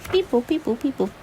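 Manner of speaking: high little phone beeps; imitated dial beeps. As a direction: voice the keypad tones playfully.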